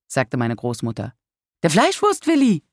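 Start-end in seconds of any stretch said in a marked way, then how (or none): 1.6-2.6 high, soaring exclamation of disbelief; incredulous exclamation. A narrator's reading. Grandchild reacting to grandmother's old photo, exclaiming. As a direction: cry it out in a much higher voice. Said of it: "rief ich".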